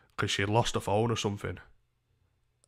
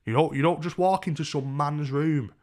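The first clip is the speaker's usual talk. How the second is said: higher, louder irritated warning; annoyed; 6 semitones higher, 6 dB louder than usual. Retold or imitated. Grown man warning stranger out of his room, annoyed. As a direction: firmly warn her off, voice raised. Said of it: "I was annoyed about it".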